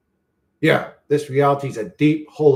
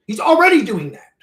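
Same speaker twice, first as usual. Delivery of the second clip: angry retort; 9 semitones higher, 8 dB louder than usual. Real, not acted. Repeating an angry point.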